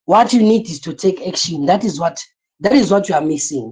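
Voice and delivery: ordinary delivery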